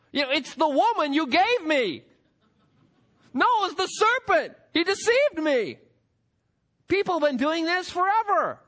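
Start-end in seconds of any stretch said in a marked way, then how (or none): 0.1-5.8 high-pitched mock protest; mock blame-shifting. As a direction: push the blame away in a raised, put-on protest. Mimics Adam and Eve blaming others.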